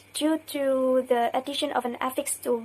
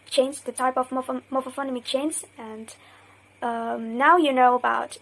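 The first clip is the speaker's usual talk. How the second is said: normal talking voice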